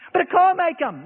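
mock fearful wife; frightened wife. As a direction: object like a scared wife. Wife objecting.

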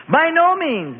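high, loud horrified cry; horrified protest; in horror. A narrator's reading. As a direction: recoil in shock at the order. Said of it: "the apostle said in horror".

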